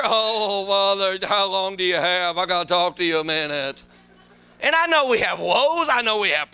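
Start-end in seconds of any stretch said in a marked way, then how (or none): 0.0-3.8 lower, heavy sighing voice; a touch of a gloomy brother; mock gloomy complainer.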